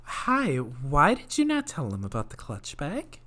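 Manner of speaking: a hint of a sweet but stern manager; mock her fake-polite boss voice; lightly imitated managerial scolding. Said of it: "doing the fucking managerial voice".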